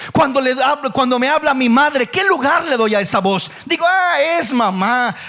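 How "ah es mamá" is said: "ah" arrastrado, con desgana; desdén actuado, alargando las palabras; fastidio fingido. Acted. Desdén fingido hacia la madre. (hijo que desoye la llamada de su madre, con fastidio fingido)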